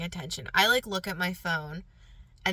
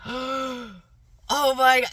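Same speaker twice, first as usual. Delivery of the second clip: higher, louder retold amazement; overwhelmed astonishment; 5 semitones higher, 5 dB louder, much slower than usual. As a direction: relive being stunned, swelling on "my God". Reliving her astonishment at the blessing.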